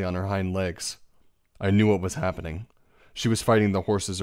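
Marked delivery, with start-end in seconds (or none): none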